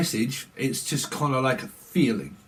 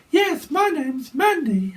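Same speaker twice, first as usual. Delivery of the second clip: much higher, slow falsetto contestant; high put-on voice; 14 semitones higher, 3 dB louder, slower than usual. Contestant three on Blind Date introducing himself, in a slow high falsetto. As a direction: squeak it out slowly like a man faking a woman. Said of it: "I've got, like, a voice that kinda goes like that".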